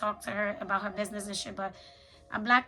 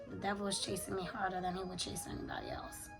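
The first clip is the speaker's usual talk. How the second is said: whispering; 2 semitones lower, 8 dB quieter than usual. Real, not acted. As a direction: whisper it like a warning. Confiding about the devil.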